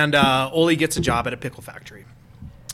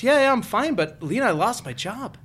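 put-on South Dakota accent; 7 semitones higher than usual. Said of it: "I like how you did the South, uh, Dakota".

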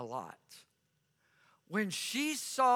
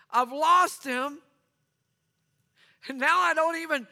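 anguished, higher and building louder; a woman at the empty tomb cries out in grief; grieving cry; 4 semitones higher, 4 dB louder than usual. Acted. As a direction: pour out a mourning woman's despair. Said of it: "and she is crying".

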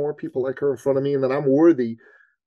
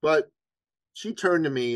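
ordinary delivery, nothing marked

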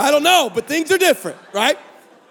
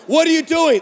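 higher, eager exclamation; exclaimed text; 6 semitones higher, 3 dB louder than usual. Retold or imitated. Pushy texter sending message after message, exclaiming. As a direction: fire it off like an excited text.

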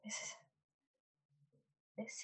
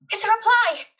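higher and louder excited cry; 17 dB louder, faster than usual. Real, not acted. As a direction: burst out with glee. Excited to get a reply.

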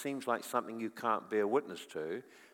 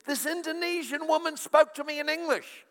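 put-on high, loud excited child voice; bubbling over like a thrilled nine-year-old; a girl rushing to tell her dad; excited daughter; 6 dB louder than usual.

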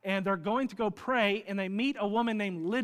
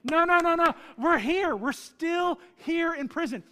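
much higher, louder urgent plea; urgent shout; 10 semitones higher, 8 dB louder than usual. Retold or imitated. Paul and Silas stopping the jailer's suicide, urgent shout. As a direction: stop him from killing himself. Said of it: "Paul and Silas say".

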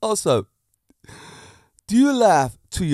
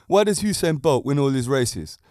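ordinary delivery

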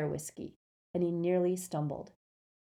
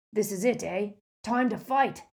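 hearty giant ready for battle; lightly voiced gamekeeper, eager to fight; rough Hagrid voice; 3 semitones higher, 5 dB louder than usual. A narrator's reading. Hagrid character voice.

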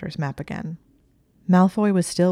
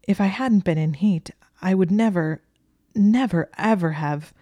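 higher, louder flustered pleading that falls away; distressed protest breaking off; voice failing; 3 semitones higher, 5 dB louder than usual. A narrator's reading. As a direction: insist emphatically, then falter. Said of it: "her voice failed her".

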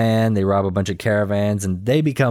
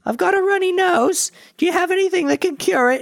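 very high mock lady voice; squeaky put-on voice of a sniffly lady; lady customer. Lady asks apothecary for cure.

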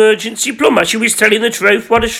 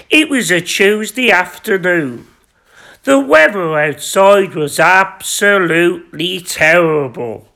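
usual speaking voice

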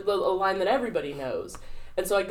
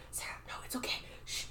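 whispered, mock soothing shush; hushed consoling; 14 dB quieter, much faster than usual. Scene partner comforting a crying Sarah, hushed. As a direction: imitate a whisper to calm someone crying. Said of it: "he's, like, acting".

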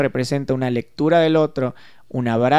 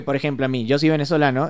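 usual speaking voice

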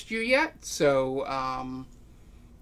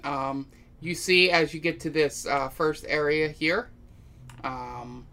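scene setting; 3 semitones lower, slower than usual. Acted. GM sets the scene before battle.

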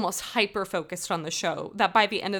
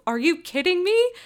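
incredulous glare; 10 semitones higher, 7 dB louder than usual. Retold or imitated. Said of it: "he gave me this glare".

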